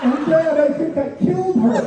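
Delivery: very high, loud child wail, hammed-up; tearful little girl. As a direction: imitate a distraught daughter confessing to Dad. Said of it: "she came to me crying".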